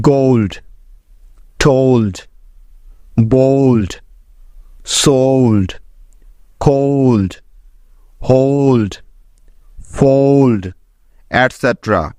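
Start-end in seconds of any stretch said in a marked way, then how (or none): 0.1-10.6 গোল্ড said with a gliding "oh-oo" before the "ld"; one slow word at a time, the ও in কোল্ড as "ow"; teacher modelling the "old" sound in English words; clear model pronunciation. Shown as a demonstration. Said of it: "এলডি এর কিছু উদাহরণ".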